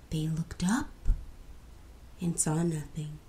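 suspense whisper. A narrator's reading. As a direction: hush it, build dread. Spooky story whisper.

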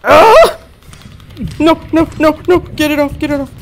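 panicked high screams; phobic panic. Real, not acted. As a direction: scream in real terror. Creature attacks in VR.

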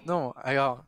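ordinary delivery

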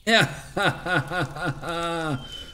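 slow, stretched evil cackle; hammed-up villain's laughter. Hams up a villain while burning everything.

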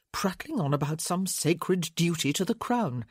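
ordinary talk, nothing marked

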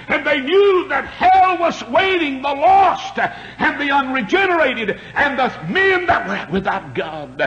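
rising and falling revival preacher, shouting peaks; fiery preaching. Acted. Revival preacher to his congregation, fiery preaching.

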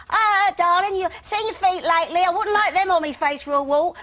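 high, loud jeering catcall; street heckler. Retold or imitated. Man in the street jeering at a young girl, loud and high. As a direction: shout a crude joke at a passing girl. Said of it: "the first thing I ever heard was".

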